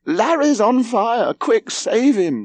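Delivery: very high, drawn-out panicked cry; screamed. A narrator's reading. Mother learning her son is burning, screaming. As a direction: scream for help, stretching the words. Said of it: "she screamed".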